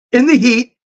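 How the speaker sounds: echoing the heat, pitched well up; a high, whiny repeat of the heat; mock complaint. Telling viewers about the heat, high mock whine.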